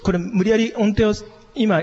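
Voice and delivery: ordinary talk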